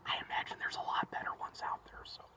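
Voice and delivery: soft whispered aside. Real, not acted. Aside whisper about Louisiana.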